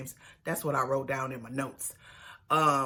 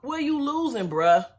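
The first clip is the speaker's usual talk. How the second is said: much higher, louder jab; mock coach retort; 9 semitones higher, 4 dB louder than usual. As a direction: needle him like a blunt coach. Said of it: "when she tells Marcelino".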